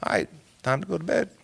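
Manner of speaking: soft, quick "time to go to bed"; tired mutter. Retold or imitated. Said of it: "I'm like".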